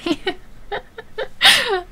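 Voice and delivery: high-pitched helpless laugh; embarrassed laugh. Real, not acted. Laughing at her own ignorance.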